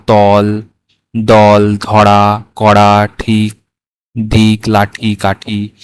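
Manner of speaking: each word read out slow and clear for pupils; slow word-list reading. Shown as a demonstration. Teacher reading vocabulary words to pupils, slow and clear.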